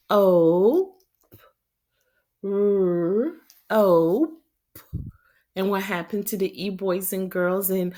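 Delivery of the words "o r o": each letter called out loud; loud letter calls. Shown as a demonstration. Teacher spelling aloud to kids, loud. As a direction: call out every letter for the class. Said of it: "Let's look for the O sound".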